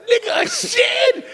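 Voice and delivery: very high, slow shout of disbelief; drawn-out squeal of disbelief; incredulous shriek. Retold or imitated. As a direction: shriek it like the idea is absurd. Disbelief at shed offer.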